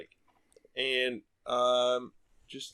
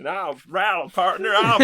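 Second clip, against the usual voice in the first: mock Wild West accent; 6 semitones higher, 9 dB louder than usual. Host mocks the reading in a hammed-up Wild West accent. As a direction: tease the accent back, Wild West style. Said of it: "like a Wild West thing".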